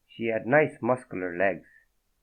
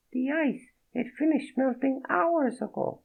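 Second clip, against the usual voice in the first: high, breathy-free French woman's voice, much higher; a startled French woman, voiced high; French accent. Naked woman startled by Fredo, in a high French accent. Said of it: "Thick French accent".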